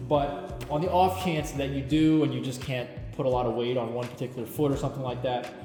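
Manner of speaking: ordinary talk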